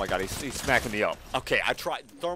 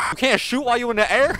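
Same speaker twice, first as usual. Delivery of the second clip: high, loud disbelieving cry; shocked you can't fire mid-air; a real startled exclaim; surprised outburst; 10 semitones higher, 10 dB louder than usual. Real, not acted. Surprised during a bird battle.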